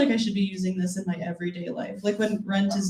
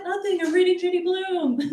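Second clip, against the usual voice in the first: put-on higher, hurried guilty kid voice; 9 semitones higher than usual. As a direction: cover up like a caught child. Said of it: "I was like".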